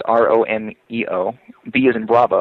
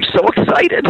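mock excitement; 4 dB louder than usual. Prank caller hearing the mop pitch.

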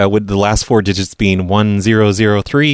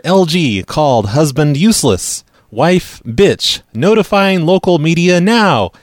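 drawn-out, higher mock tweet reading; mock outrage; 5 semitones higher, slower than usual. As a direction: read an irate tweet mockingly, stretching words.

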